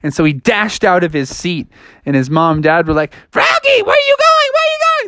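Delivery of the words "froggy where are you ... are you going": very high-pitched frantic squawk; shrill-high worried parent voice, acted; alarmed parents calling. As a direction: call after a runaway child in falsetto. Parents call after fleeing Froggy.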